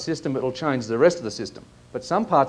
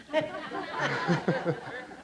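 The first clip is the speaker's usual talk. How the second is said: laugh; 6 semitones higher, 6 dB quieter than usual. Real, not acted. Laughs at own accent attempt.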